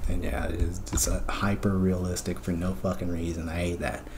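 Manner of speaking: whispering in tired annoyance; whispered grumble. Real, not acted. Narrator grumbling about the dreams, in a whisper. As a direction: breathe it out under your breath, fed up.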